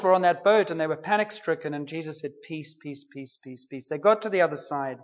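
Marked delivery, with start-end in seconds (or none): 2.5-3.9 very soft, low hush; soothing peace. Retold or imitated. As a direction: hush the storm gently. Jesus calming the storm.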